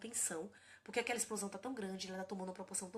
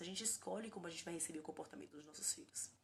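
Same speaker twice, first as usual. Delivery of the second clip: soft whispered reflection; 4 semitones lower, 8 dB quieter than usual. Real, not acted. Mother sharing a lesson with parents, whispered. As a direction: breathe it out in a whisper.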